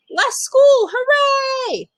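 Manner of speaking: put-on high, loud kid cheer; gleeful shout of hooray; excited cheer. Kid cheering about less school.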